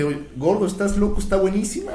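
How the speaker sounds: imitating an excited friend's rave; enthusiastic retelling. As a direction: gush as the friend who loved it. Retold reaction to the film, enthusiastic.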